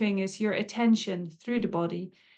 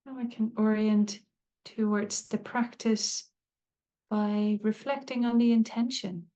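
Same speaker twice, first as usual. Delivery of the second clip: calm, unhurried meditation voice with long pauses; guided meditation. Acted. Teacher leading listeners into a body scan, calm meditative guiding. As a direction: guide them gently, leaving space between phrases.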